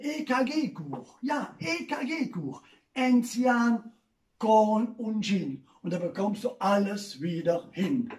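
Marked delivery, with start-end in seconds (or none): 0.0-5.6 slow, letter by letter; spelled out slowly. Shown as a demonstration. Comedian spelling out his party tip slowly. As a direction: draw out each letter. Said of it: "spelling out the joke".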